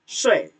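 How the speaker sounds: "Sui" with a short falling fourth tone; nada keempat. Shown as a demonstration. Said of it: "Tidur nada empat". ("Sui" nada empat, pendek dan jatuh)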